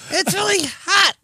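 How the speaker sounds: high squeaky kobold chatter. Acted. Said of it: "I got stuck in kobold voice".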